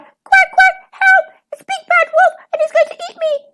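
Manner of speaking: very high, loud duckling panic; piping, panicked baby duck; frightened cry. A narrator's reading. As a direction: squeal for help in terror. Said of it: "cried number five".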